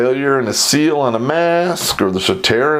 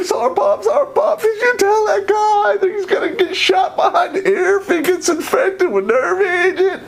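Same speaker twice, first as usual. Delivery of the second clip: laughing hysterically. Retold or imitated. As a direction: ask it while cracking up with laughter. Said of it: "He is laughing hysterically".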